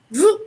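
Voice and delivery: sudden high, loud vroom; imitated whoosh. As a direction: imitate something darting past with a burst of sound. Imitates mole darting up behind her.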